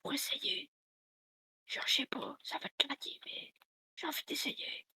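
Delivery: soft ASMR whispering. Pretend. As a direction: whisper close to the mic. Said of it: "faire une séance A S M R".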